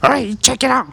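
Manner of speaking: high goblin voice; bossy goblin, pitched high. Acted. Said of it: "you hear".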